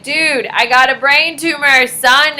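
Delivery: bro swagger. Retold or imitated. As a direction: play it cocky. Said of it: "And I'm like".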